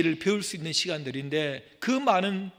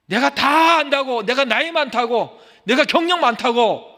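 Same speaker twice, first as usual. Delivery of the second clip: raised voice, scolding; loud rebuke; 9 semitones higher, 12 dB louder than usual. Acted. Preacher scolding stubborn elders, loud. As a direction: call the proud to order.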